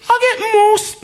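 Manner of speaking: high, loud squeal of disbelief; incredulous outrage. Retold or imitated. Retelling the wage offer with a high outraged squeal. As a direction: shriek it like the offer is an insult.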